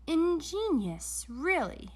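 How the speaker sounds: high, slow forced praise; lightly voiced uneasy compliment; Doctor Brock, nervous. A narrator's reading. Doctor Brock praises the reducer, high and slow.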